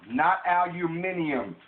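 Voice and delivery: "aluminium" loud and slow, al-yoo-MIN-ee-um; high, emphatic "aluminium" ending in -ee-um; rejected pronunciation. Shown as a demonstration. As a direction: holler "not aluminium", stretching the five syllables. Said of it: "Learn how to pronounce it".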